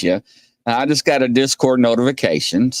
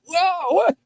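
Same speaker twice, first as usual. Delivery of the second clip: mock surprise. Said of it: "Peter was like".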